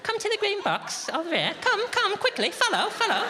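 very high, coaxing call; high baby-talk coaxing; coaxing pet voice. Retold or imitated. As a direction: coax it along like a puppy.

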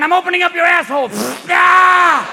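high, furious mock shouting; protesting heart character. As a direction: yell back in outraged protest. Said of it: "the heart's going".

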